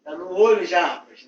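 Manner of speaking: loud complaint. Acted. Boss complains about his eye.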